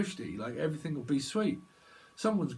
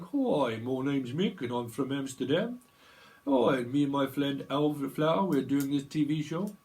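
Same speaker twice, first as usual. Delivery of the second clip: hammed-up, badly faked Dutch accent; bad Amsterdam accent; 4 dB louder than usual. Mick from Amsterdam introduces a TV show, bad Dutch accent. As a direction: play a TV presenter with a fake Amsterdam accent. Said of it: "That's a bad accent".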